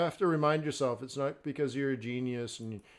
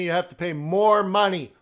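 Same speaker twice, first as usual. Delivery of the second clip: loud, higher insisting; a mock-stern push, louder than usual; firm insistence; 6 semitones higher, 11 dB louder than usual. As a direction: insist on more money, raised voice. Playful scolding of Jamie.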